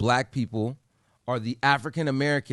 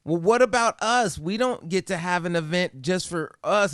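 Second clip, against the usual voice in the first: higher, louder mock of an indignant lady; 7 semitones higher, 4 dB louder than usual. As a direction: ham up her whiny protest.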